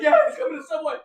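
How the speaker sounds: loud, high, laughing warning; laughing alarm. Retold or imitated. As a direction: blurt it loudly, half laughing. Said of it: "He's like".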